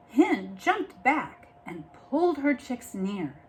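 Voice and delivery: usual speaking voice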